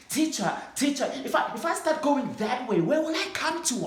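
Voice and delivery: put-on excited small boy asking a big question; eager little boy. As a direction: call up to the teacher like a ten-year-old. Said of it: "I asked".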